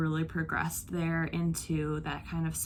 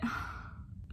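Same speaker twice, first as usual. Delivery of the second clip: heavy sigh; 11 dB quieter than usual. Real, not acted. After finishing an emotional book.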